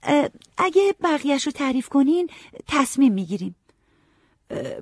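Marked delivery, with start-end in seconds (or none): 0.0-3.6 put-on girl's voice, much higher; a girl steadying herself to bargain, pitched well up; Molly's higher, firmer voice; Molly, pulling herself together.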